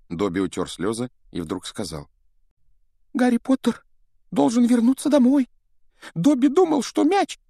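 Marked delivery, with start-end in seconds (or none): 3.1-7.4 high, squeaky pleading elf; house-elf voice. Acted. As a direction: play Dobby the anxious house-elf. Dobby insists. (тонкий голос домового эльфа)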